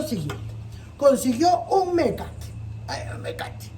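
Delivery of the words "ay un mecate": breathy whisper under the breath; whispered aside. Acted. Rabbit whispering to himself about the rope. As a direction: whisper it like a secret.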